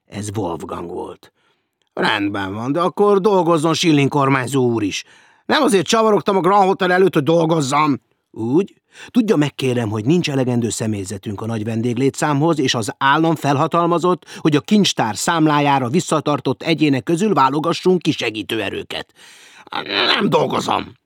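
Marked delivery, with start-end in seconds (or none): 1.9-8.0 loud, higher indignant protest; indignant retort. Acted. Hecker snaps back at Wolfgang. 8.3-19.1 loud, severe bureaucratic lecture; stern lecture. Acted. Wolfgang lectures Hecker. 19.8-20.9 flat refusal. Acted. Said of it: "felelte Hecker".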